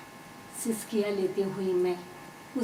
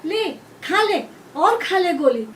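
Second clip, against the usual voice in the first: slow, loud, high jeer; punishing mother's shout; angry taunt; 8 semitones higher, 8 dB louder, slower than usual. A narrator's reading. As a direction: force each word on the child in fury. Furious mother smears ghee, taunts child.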